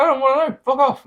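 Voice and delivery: high, dismissive wrestler voice; mock brush-off. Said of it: "Stevie Ray's like".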